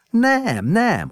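high, loud bratty yell; a defiant child screaming back. A narrator's reading. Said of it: "ordított vásottan". (vásott ordítás)

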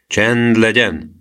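slow sergeant's grumble; grumbled order. A narrator's reading. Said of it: "dörmögte az őrmester".

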